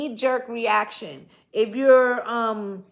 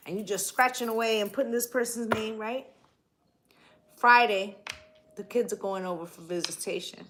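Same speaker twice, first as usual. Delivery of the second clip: usual speaking voice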